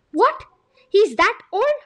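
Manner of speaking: shocked, high and loud; surprised. Acted. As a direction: blurt it out in disbelief. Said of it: "rudely interrupting".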